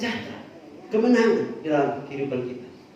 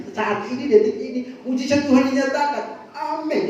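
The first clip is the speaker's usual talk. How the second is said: bold prayer, pitched higher and louder, building to the amen; fervent prayer; 10 semitones higher, 4 dB louder than usual. Retold or imitated. Preacher praying over a woman in debt, fervent and loud. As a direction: proclaim the miracle over her like a preacher in full flow.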